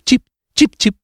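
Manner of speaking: high chirped cip cip like a bird; swallow chirping. Acted. The swallow calling down to Mignolina, high bird chirp. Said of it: "si sentì d'improvviso cip".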